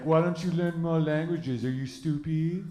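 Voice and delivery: put-on French-accented scolding question; put-on French accent, slowing at the end; mock French accent. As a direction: imitate a Frenchman talking down to an American. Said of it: "this one French guy".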